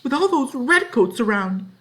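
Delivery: very high, loud protest; shrill outburst. Acted. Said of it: "high, shrill voice".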